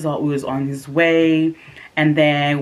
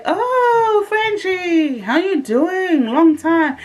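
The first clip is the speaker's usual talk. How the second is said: much higher, gushing fake delight; mock delight; 13 semitones higher, 4 dB louder, much slower than usual. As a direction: overdo the surprised joy, sweetly fake.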